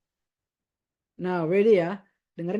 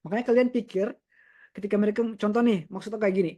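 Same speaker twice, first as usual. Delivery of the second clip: normal talking voice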